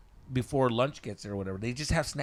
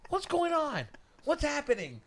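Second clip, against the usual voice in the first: higher, puzzled questions; mock confused director; 14 semitones higher than usual. Director on set asks what's happening, mock puzzled. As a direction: lightly imitate the baffled director.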